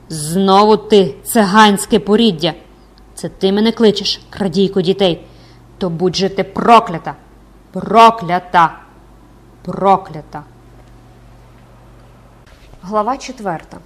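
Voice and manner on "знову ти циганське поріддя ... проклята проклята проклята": loud, drawn-out raging curse; hoarse screamed curse. Acted. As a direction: curse slowly and forcefully, like a death rattle. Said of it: "закричала голосом, що скоріше нагадував передсмертне хрипіння".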